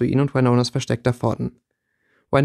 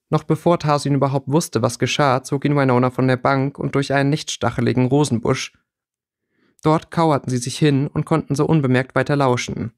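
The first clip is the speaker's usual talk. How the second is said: ordinary delivery, nothing marked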